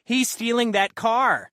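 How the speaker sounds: high, loud, slow mock scream. Woman shrieking to traffic that he steals the car, a slow high mock scream. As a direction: shriek the accusation for passing traffic. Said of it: "she screams".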